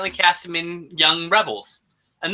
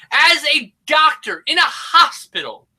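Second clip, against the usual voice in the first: incredulous; 10 semitones higher, 4 dB louder than usual. Real, not acted. Incredulous recap of plot.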